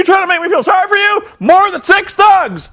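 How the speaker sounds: high, loud mock shout; indignant wrestler. Jeff Jarrett defying Eric Bischoff, high hammed-up shout. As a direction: ham up an outraged veteran's protest.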